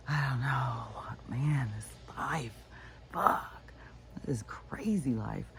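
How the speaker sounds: slow, breathless whisper to himself. Real, not acted. Vlogger overwhelmed by his crazy life, whispered. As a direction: hush it out, almost to himself.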